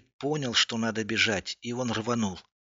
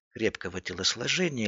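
ordinary delivery, nothing marked

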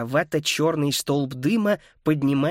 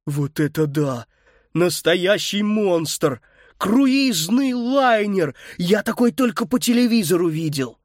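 amazed; 5 semitones higher, 3 dB louder, slower than usual. A narrator's reading. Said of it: "открыл рот от изумления".

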